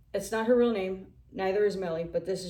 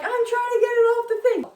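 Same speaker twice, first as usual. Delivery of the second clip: very high, loud whiny protest; mock squeaky self; 8 dB louder than usual. Herself protesting to the manager, high and whiny. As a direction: whine it out defensively.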